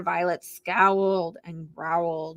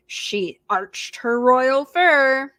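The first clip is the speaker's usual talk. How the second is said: usual speaking voice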